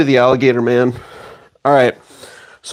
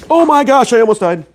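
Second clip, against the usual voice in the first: startled; 8 semitones higher than usual. Real, not acted. Near fall while walking.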